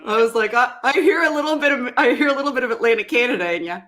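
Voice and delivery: tickled at spotting a familiar accent; laughing delight. Real, not acted. Host teasing caller about Atlantic Canada, laughing delight.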